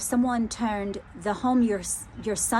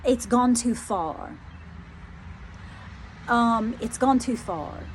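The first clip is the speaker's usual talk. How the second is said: slow spirit warning; slower than usual. Retold or imitated. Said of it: "Oh, okay".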